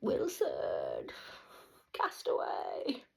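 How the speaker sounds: loud cry across open water; acted calling out. Recalling a Tom Hanks film, calling out loudly. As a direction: holler it like a stranded castaway.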